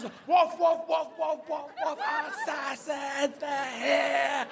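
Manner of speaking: high barks stretching into howled words; bark, then drag the alarm out; very sure dog. Acted. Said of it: "No, it's, it's".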